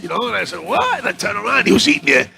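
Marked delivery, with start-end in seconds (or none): 0.7-1.0 very high surprised what; startled what. Retold or imitated. Caught by the cop at the counter, very high startled. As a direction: jump at the sound.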